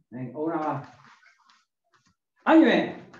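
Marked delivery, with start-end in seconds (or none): 2.4-3.1 barking gym coach. Real, not acted. Karate coach calling kiai command.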